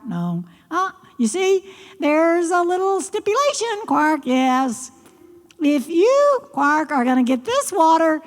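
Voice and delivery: high, fast croaky frog voice with quark sounds; a cheeky frog scolding the girl; croaking frog. Acted. Frog sets terms.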